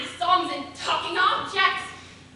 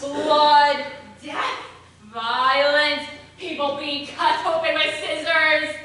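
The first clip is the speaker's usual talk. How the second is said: gleeful loud; 3 semitones lower, 7 dB louder, slower than usual. Acted. Listing gory endings gleefully.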